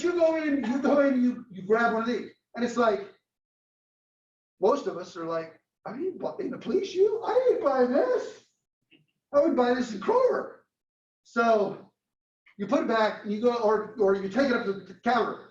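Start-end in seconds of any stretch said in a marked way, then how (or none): 5.9-10.5 incredulous scoff. Retold or imitated. Skeptical customer at the counter.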